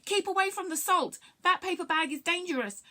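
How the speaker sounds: high-pitched, urgent warning; panicked shouting. A narrator's reading. Aliens warn each other off the salt, panicked. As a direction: cry it out high in alarm. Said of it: "in a panic, shouting things".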